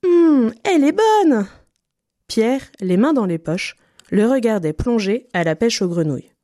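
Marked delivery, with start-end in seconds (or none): 0.1-1.5 high, slow, savouring exclamation; delighted duck. A narrator's reading. Duck enjoying the pond water, high, loud and delighted. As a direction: relish it loudly, drawn out and high.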